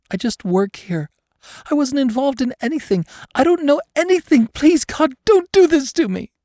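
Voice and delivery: high, pleading whimper; a terrified woman begging not to be hurt. A narrator's reading. Captive woman begging her torturer, high whimper. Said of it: "she whimpered".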